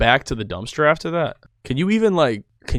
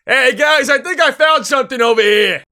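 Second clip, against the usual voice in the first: high, louder call to friends; mock calling out; 13 semitones higher, 7 dB louder than usual. Dumpster diver calling friends over, high hammed-up call. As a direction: sing out the discovery casually, hammed-up.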